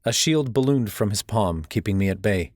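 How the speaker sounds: ordinary delivery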